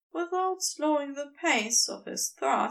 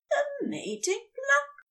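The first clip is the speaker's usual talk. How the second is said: much higher, curious asking; higher Aziraphale, asking in surprise; Aziraphale's surprised question; 12 semitones higher than usual. Acted. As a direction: voice him high and startled.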